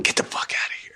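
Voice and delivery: breathless unvoiced disbelief; stunned whisper. Young fan hearing his heroes are coming, whispering in shock. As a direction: hiss it like you can't believe the news.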